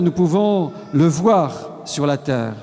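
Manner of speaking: normal talking voice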